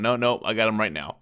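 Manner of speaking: ordinary talk, nothing marked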